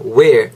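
Where with a strong curled American R, drawn out. Shown as a demonstration. A teacher modelling the American r in Where, slowly. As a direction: let the R ring in Where. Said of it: "R 사운드를 좀 더 지켜주셔야".

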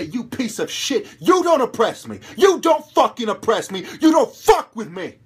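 loud, high yelling threat, building; furious shout. Retold or imitated. Telling the oppressor he won't be pushed around, shouting. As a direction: scream it into the oppressor's face. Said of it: "And say".

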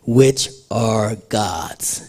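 "which are God's" slow and word by word; stress on possession. Shown as a demonstration. Preacher showing who owns body and spirit, slow deliberate stress. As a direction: spell out "which... are... God's", each word set apart. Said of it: "look at the preposition or the possession there".

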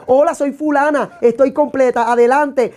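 mock introduction.

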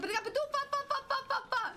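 high babbling into stuttered syllables; panicked screaming. Real, not acted. Streamer who can't see the door, screaming in panic. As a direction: wail and gibber in a panic. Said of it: "I'm sorry for screaming".

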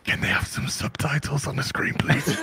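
hushed whisper aside. Retold or imitated. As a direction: whisper it to the person beside you. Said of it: "I was like".

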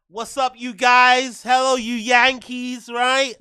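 loud, drawn-out mock American greeting; bad American accent. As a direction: put on a hammy American accent, stretched out. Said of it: "that's not a good accent".

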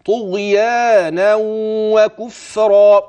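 طغيانا وكفرا slow, tanween merged with a nasal hum; slow recited طغيانا وكفرا; merging with ghunna. Shown as a demonstration. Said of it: "وأدي كمان الإدغام".

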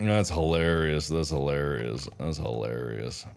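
slow, low, unamused repetition; deadpan sarcasm. Real, not acted. Victim of friendly fire, unamused.

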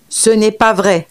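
plain reading voice

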